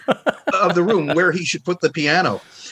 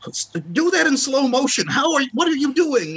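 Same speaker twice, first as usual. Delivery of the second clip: amazed younger self; 11 semitones higher than usual. Retold or imitated. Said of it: "I would just go".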